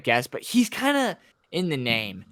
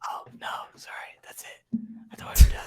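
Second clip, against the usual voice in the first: whispering; faster than usual. Real, not acted. Realises he cut in, apologises.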